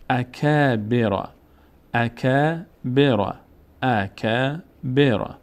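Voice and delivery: اکبر very slow, long aa on کا; slow recitation. Shown as a demonstration. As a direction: draw out اکبر for pupils.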